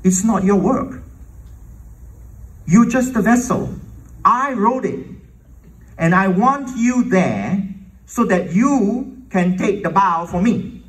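the Lord's rebuke. Retold or imitated. The Lord correcting a reluctant composer, stern and emphatic. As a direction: set them straight, high and forceful. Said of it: "And the Lord said".

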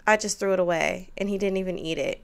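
usual speaking voice

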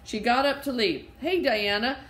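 put-on lively read-aloud to children; story reading. A narrator's reading. Teacher reading the story to kids, lively. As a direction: read the chapter with bright character voices.

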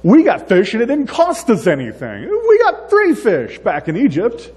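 high, pleading mock whine, climbing to the top; whiny complaint. Israelites pleading to Moses about fish, whiny. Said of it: "that's kinda what they were saying".

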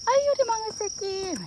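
high, doting, loving tone; doting coo. Shown as a demonstration. Owner shows that a loving tone hides the insult, doting coo. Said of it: "감정을 담으면 알 수가 없어".

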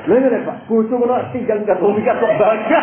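high, loud back-and-forth; heated mock argument. Preacher acting out a quarrel over a runaway wife, high and loud. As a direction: bark it like two men quarrelling.

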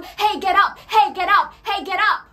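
loud repeated wake-up call. Acted. The Lord calling a sleeping believer, loud and insistent.

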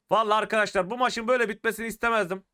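ordinary delivery

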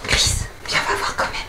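hushed whisper in hiding; whispering while hiding. Real, not acted. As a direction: whisper so they won't hear. Sneaking down, whispered.

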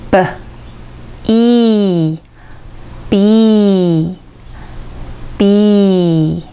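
short "ブ", long "イー", then joined as slow "ビー"; slow, stretched "ビー" for learners; letter B sounded out. Shown as a demonstration. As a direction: build "ビー" from lip burst and held ee. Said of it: "長く伸ばす母音".

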